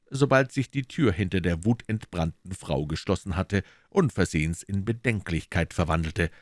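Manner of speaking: ordinary delivery, nothing marked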